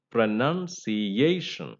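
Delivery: slow pronunciation, weight on NUN and A; primary and secondary stress. Shown as a demonstration. Teacher models the proper way, pronunciation slowly stressed on NUN and A. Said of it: "the way we should say".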